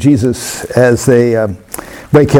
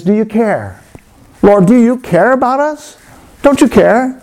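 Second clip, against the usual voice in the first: desperate plea; 8 semitones higher than usual. Acted. Frightened disciples beg Jesus, building desperation. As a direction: plead harder each time, close to crying out.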